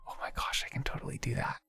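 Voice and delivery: breathless hushed gasp; gasp, then whisper it fast; excited whisper. Real, not acted.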